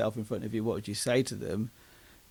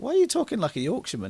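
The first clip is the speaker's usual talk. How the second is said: higher, fast mock-incredulous sister; sister's question; 10 semitones higher, 4 dB louder, faster than usual. Sister quizzing her brother after Barnsley drinks, higher and fast. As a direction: rattle it off high like a baffled sister. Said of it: "My, my sister was like".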